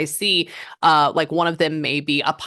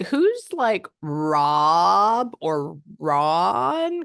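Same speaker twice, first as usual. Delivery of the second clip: slow drawn-out guess; 3 semitones higher, much slower than usual. Retold or imitated. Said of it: "And I was like".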